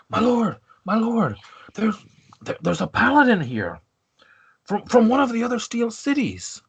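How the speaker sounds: high-pitched, urgent call; flustered underling announcing a visitor, voice pitched high; guard calling to his lord. Acted. Guard calling through to his lord about the paladin, urgent. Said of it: "you kind of hear him saying on the other side".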